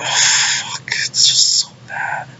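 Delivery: slow, breath-only groan of dismay; despairing whisper. Real, not acted. Fan despairing over the episode, slow pained whisper. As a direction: whisper it slowly, utterly let down.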